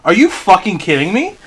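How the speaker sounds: ordinary talk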